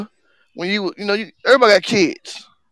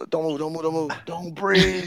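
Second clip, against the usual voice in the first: much softer, low urgent warning; hushed freeze; 5 semitones lower, 11 dB quieter than usual. Acted. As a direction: freeze and barely let it out. Acting out freezing still.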